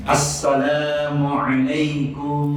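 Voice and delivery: Assalamualaikum drawn out slowly, chanted; long drawn greeting. Shown as a demonstration. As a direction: stretch Assalamualaikum slow and melodic. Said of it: "dengan bacaan yang panjang".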